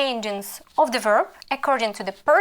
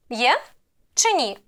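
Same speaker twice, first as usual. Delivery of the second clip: ordinary delivery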